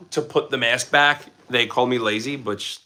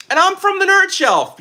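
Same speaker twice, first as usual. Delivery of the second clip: high, loud announcer pitch for Nerd Shelf; hype channel intro; 5 dB louder than usual. Real, not acted. Streamer introduces himself to chat, hyped announcer. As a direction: sell the channel name big.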